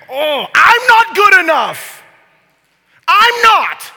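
slow, very high, very loud cry; full shout. Acted. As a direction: bellow it out at the top of your voice. Acts out the excuse, shouted.

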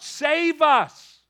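loud, high plea; desperate crowd shout; yelling out. Retold or imitated. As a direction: cry it out to be saved. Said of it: "They were literally yelling out".

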